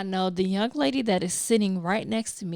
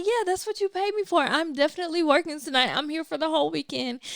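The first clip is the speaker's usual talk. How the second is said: much higher, quick and eager; eager yes; 8 semitones higher, faster than usual. Retold or imitated. To Prophetess Diane about filming, eager and quick. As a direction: answer brightly, of course I'm working.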